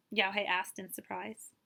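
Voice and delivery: usual speaking voice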